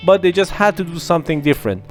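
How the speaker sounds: usual speaking voice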